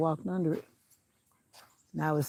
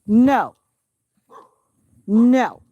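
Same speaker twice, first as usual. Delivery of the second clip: loud, firm no to a dog; shouting a stern no at the barking dog; sharp command; 6 semitones higher, 13 dB louder than usual. Real, not acted. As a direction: bark it out to stop the dog. Warning off the other dog.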